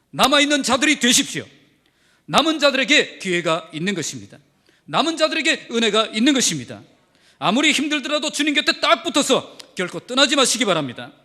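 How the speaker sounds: loud pleading exhortation; the pastor urges his flock to stay, loud and fervent; fervent preaching. Real, not acted. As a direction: urge the congregation to stay, loud and firm. Preaching at full force.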